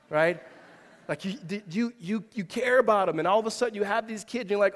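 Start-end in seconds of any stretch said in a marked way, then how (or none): none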